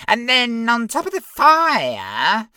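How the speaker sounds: very high smug little imp; giggly sneering imp. Acted. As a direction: squeak it out smugly. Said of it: "the imp continued".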